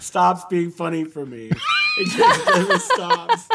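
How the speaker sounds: squealing high laugh through the words; can barely get the words out for laughing. Real, not acted. Cracking up at the impression.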